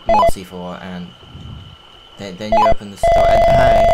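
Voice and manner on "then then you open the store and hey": slide whistle sound. Acted. Whistling noise over game talk.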